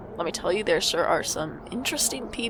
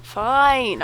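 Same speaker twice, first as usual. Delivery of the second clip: louder, give in with a huff; exasperated fine; 4 semitones higher, 8 dB louder than usual. Acted. Micah giving in to the nagging friend, exasperated. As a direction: blurt it out fed up.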